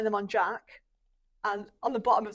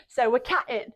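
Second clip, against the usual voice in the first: sing-song teacher cues building to loud; class instructor; 2 semitones higher, 9 dB louder than usual. Retold or imitated. Teacher leading a baby yoga class, cheerfully building louder.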